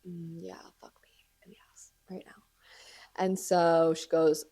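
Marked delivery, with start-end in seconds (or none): 0.1-2.4 whispered, resigned mutter; defeated whisper. Retold or imitated. Told her hair is wrong, she whispers in defeat. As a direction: whisper it like giving up. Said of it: "And I'm like".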